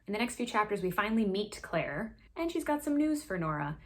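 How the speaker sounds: ordinary delivery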